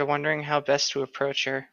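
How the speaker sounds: ordinary talk, nothing marked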